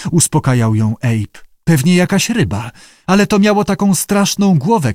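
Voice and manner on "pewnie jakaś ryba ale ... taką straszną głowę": high, whiny frightened voice; whimpering. A narrator's reading. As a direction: whimper it like a scared kitten. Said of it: "kwilił koteczek". (kwilenie przestraszonej dziewczyny)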